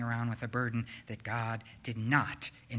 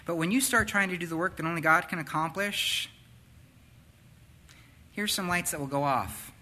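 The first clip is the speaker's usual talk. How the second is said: ordinary talk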